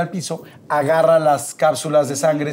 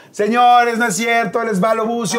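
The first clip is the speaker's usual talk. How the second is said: raised, higher, louder announcer voice; announcing to the crowd; 6 semitones higher, 3 dB louder than usual. Retold or imitated. The host reveals the prank to the audience, loud and high. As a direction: present him to the audience with a flourish. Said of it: "volteó a Adal y dijo".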